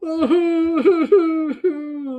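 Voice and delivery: high, loud fake crying wail; mock sob. Host parodying a podcaster's sobbing, loud mock wail. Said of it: "she starts, like, whimper".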